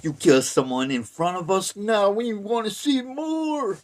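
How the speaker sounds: loud cow voice climbing high; demanding cow herd. Retold or imitated. Voices demanding cows in story.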